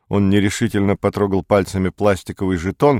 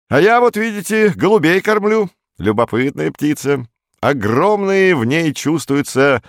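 much higher, ringing chatty voice; director; 8 semitones higher, 3 dB louder than usual. A narrator's reading. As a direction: show off a hobby in a high, lilting voice. Director chats about pigeons, ringing voice. (директор, высоким звонким голосом)

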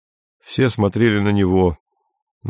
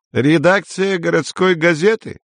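high-pitched, official telephone inquiry; businesslike caller; 8 semitones higher than usual. A narrator's reading. A stranger phones the newspaper office, businesslike. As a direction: inquire formally in a raised pitch. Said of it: "осведомился деловой голос".

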